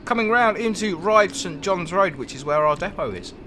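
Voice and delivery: talking around an ice cube; words slurred by ice in the mouth. Real, not acted. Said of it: "I've got an ice cube in my mouth".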